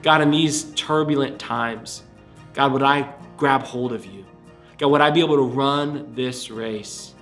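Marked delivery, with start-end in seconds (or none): none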